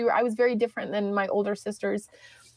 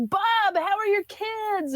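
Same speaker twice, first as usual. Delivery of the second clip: cheery greeting; 9 semitones higher, slower than usual. Retold or imitated. Sister greeting produce guy.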